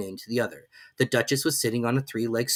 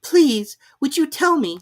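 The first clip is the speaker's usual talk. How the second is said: put-on high, hesitant girl; timid Alice; 8 dB louder, slower than usual. A narrator's reading. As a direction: ask shyly. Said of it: "a little timidly".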